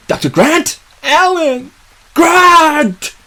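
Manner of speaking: high, drawn-out searching calls; calling out. Acted. Searcher calling for Doctor Grant at the wreckage, high holler. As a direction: holler for someone missing.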